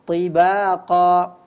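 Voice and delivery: TIBAQA chanted slowly with long vowels; demonstrated letter ta. Shown as a demonstration. Quran teacher showing the reader the letter, slow recitation. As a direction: draw out TIBAQA, showing the ta sound. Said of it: "huruf ta berganti menjadi huruf ta".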